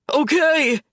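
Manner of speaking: sobbing. Acted. Brooks sobs in terror at Gavin's blade. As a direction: blurt it out through a sob. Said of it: "he sobs".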